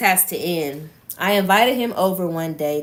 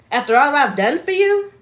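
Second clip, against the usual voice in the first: louder, higher, indignant question; seething; 8 semitones higher, 6 dB louder than usual. A narrator's reading. Sean confronting his ex at the breakup, seething. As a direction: read his challenge with rising, narrated anger. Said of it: "He seethed as he queried".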